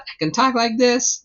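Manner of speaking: high voice. Shown as a demonstration. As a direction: jump the voice way up to show high pitch. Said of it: "that's a higher frequency".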